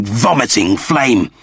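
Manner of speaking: plain reading voice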